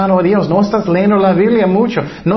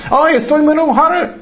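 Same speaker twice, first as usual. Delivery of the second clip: mock anger; 9 semitones higher than usual. Acted angry believer quote.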